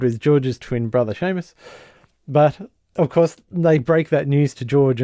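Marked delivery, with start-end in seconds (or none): none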